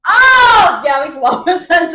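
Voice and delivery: loud, high "Oh!" of sudden understanding; shouted realisation. Real, not acted. Friend suddenly gets the plot point, shouting. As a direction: it finally clicks.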